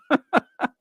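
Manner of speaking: brief high-pitched chuckle burst; amused laugh. Real, not acted. Streamer joking about saying water, laughing. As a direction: laugh at your own quirk.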